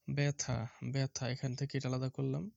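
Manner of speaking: normal talking voice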